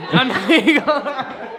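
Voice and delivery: laughing through the words; tipsy giggle while talking. Real, not acted. Tipsy after shots, laughing.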